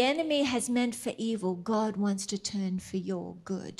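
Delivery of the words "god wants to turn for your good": soft unvoiced whisper of faith; hushed declaration. Real, not acted. Preacher whispering God's promise to the congregation, soft.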